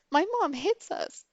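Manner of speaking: high-pitched kid imitation; little sister. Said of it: "she said the same thing".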